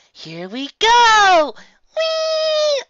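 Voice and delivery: put-on loud, drawn-out, soaring child squeal; gleeful yell. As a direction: squeal high and long like a kid on a slide. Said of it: "I yell as Molly starts".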